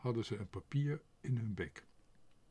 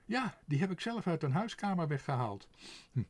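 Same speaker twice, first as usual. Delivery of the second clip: high, quick bird voice, proud of the theft; gleeful sparrow; 6 semitones higher than usual. A narrator's reading. The sparrow boasts to Tos of the stolen letter, high cheeky voice. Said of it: "riep de mus".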